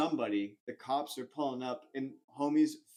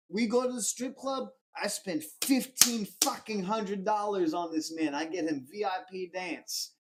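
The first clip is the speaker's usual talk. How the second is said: put-on Russian-accented broken English; 7 semitones higher, 4 dB louder than usual. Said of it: "like, broken English".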